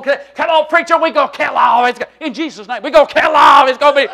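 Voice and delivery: loud mock game-day hollering; hyped-up fan. Marching fan urges the preacher on, loudly excited.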